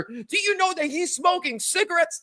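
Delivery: hint of a whiny tattling teen; tattling kid. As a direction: sell out the brother to deflect. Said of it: "start pointing finger at my older brother".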